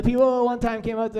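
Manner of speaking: normal talking voice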